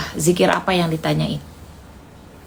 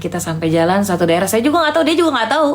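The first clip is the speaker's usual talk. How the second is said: ordinary delivery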